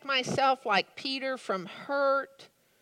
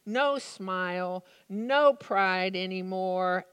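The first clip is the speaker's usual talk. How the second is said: ordinary delivery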